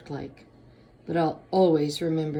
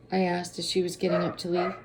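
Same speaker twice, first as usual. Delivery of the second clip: usual speaking voice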